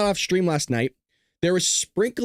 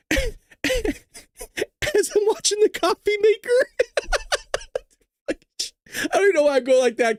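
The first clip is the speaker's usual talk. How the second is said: high, laughing and crying. Real, not acted. Describing laughing at the coffee maker, laughing till crying. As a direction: talk through tears of laughter. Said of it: "I was acting like this in the kitchen".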